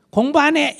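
high, loud rebellious shout; teen's defiant yell. Retold or imitated. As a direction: bark it like a sullen teenager. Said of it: "사춘기 때는".

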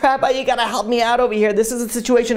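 high-pitched plea for help; acted urgent appeal. Husband runs to rabbi for help, urgently pleading. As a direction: beg for help in a rush of alarm.

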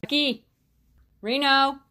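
loud, high call. Real, not acted. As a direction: shout the names to summon them. Calling out to pets by name.

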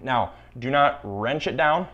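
normal talking voice

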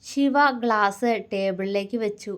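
usual speaking voice

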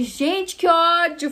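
retold fury. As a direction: vent the anger you felt. Furious at the pushy customer. (desabafo irritado, mais alto)

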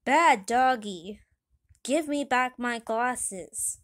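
loud, drawn-out scolding; indignant shout at a dog; angry scolding. Acted. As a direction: tell off a naughty dog, loudly and slowly. Mayor scolding the dog in story.

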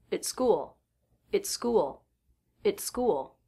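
"it's" rushed, "school" stressed and longer; quick at, long school. Shown as a demonstration. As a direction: tuck "it's" in, lean on "school". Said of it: "the word at much quicker than the word school".

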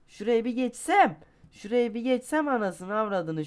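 high, loud furious threat; angry rant. Real, not acted. Gamer venting at the game, growing furious. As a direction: let the rage boil over. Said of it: "Sinirleniyorum yavaş yavaş".

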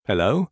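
Hello? with pitch rising at the end; rising intonation. Shown as a demonstration. Teacher showing the rising phone greeting. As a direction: ask Hello? with an upward lilt. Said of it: "L'intonation est souvent ascendante".

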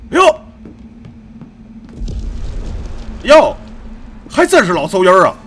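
loud, punchy exclamation of recognition; loud "well, look who it is" surprise; surprised. Real, not acted. Recognising familiar enemies in game. (惊讶地大声招呼)

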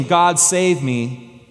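drawn out slowly, each word apart; slow prayer. Retold or imitated. Recalling his prayer to God, slow and deliberate. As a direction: linger on the old plea. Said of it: "my prayer changed from".

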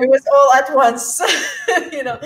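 amused laughing disbelief. Real, not acted. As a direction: talk while cracking up at the irony. Laughing at her own story.

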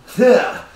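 loud high grunt; mock effort grunt. Imitating the slapper from the extended cut, a loud high grunt. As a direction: grunt like throwing a big slap. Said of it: "she even goes".

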